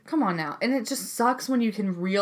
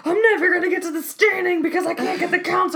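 panicked inner voice; 9 semitones higher, 5 dB louder than usual. Retold or imitated.

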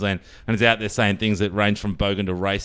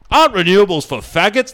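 mock ocker PM; 8 semitones higher, 6 dB louder than usual. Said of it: "he's out there going".

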